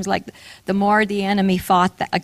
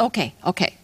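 mildly placating, lowered pitch; polite brush-off; 2 semitones lower, 4 dB quieter, faster than usual. Retold or imitated. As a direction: agree politely just to end the matter. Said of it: "he was very polite about it".

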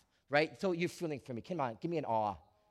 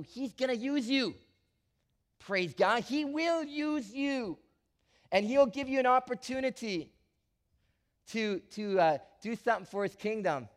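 fervent preaching; 7 semitones higher, 6 dB louder than usual. Acted. Pastor promising God's use.

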